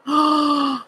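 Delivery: sudden high gasp; a real gasp of emotion. Real, not acted. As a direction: catch your breath sharply. Overcome during emotional reading.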